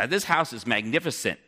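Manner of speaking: ordinary delivery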